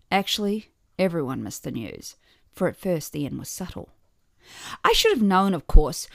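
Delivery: plain reading voice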